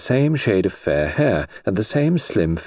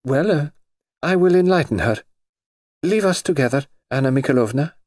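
cartoon princess; 7 semitones higher than usual. Grand Duchess dismisses lady.

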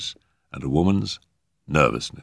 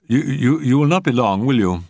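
high, loud, stammering plea; begging; 7 semitones higher, 5 dB louder than usual. A narrator's reading. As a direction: plead anxiously, tripping on the first word. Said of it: "she begged".

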